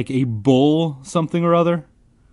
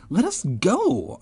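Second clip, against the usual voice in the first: mock cry; 10 semitones higher than usual. A narrator's reading. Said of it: "I cried".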